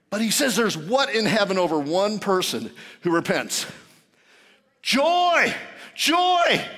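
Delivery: loud, high, driving sermon delivery; full-force preaching. Acted. Preacher tells congregation Jesus forgives from the cross, preaching loudly.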